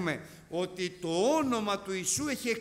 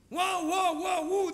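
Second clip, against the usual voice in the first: high, loud mock war cries; mock yelling; 8 semitones higher, 6 dB louder, slower than usual. Preacher mimics the boastful fighter's cries, high and loud. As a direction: yelp Ua ua like a brawler showing off. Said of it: "Άστονε να φωνάζει αυτός".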